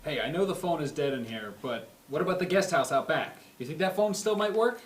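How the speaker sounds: usual speaking voice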